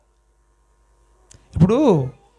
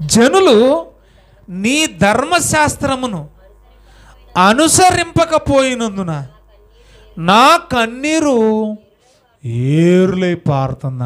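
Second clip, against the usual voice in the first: solemn scripture reading; 3 semitones higher, slower than usual. Acted. Reading David's lament verse.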